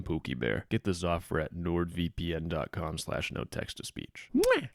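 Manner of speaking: soft, low, slow sweet-talk with a kiss; cooing pet name. Acted. Sponsor read ending as a cooing pet-name sign-off. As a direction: coo it like a lover, then blow a kiss.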